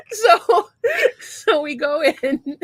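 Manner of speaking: crying with laughter, voice breaking high; laughing. Real, not acted. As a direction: laugh helplessly through the words. Laughing fit after retelling.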